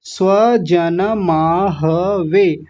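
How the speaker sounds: "स्वजनमाहवे" very slow, syllable by syllable; full vowels. Shown as a demonstration. Teacher modelling for students, slow full-vowel "स्वजनमाहवे". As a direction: say "स्वजनमाहवे" as swa-ja-na-maa-ha-ve. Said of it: "ह को भी पूरा बोलेंगे".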